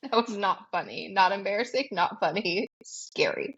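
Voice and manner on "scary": low breathy whisper of the word. Real, not acted. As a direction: drop to a spooky whisper. Punchline after listing.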